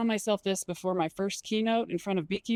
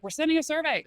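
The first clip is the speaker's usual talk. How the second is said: higher, bright pitch; upbeat announcement; 7 semitones higher than usual. Shown as a demonstration. Telling staff about a survey, upbeat. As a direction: pitch it like fun news. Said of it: "Say, like".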